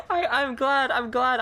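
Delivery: laughing, voice near tears; relieved laugh. Real, not acted. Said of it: "I just sighed in relief".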